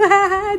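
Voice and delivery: a short, high, loud laugh; burst of laughter. Real, not acted. Laughing after her fake crying for her aunt. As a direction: break into laughter.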